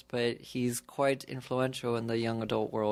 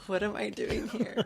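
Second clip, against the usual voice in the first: laughing retold bewilderment; 8 semitones higher than usual. Retells own bewilderment while laughing.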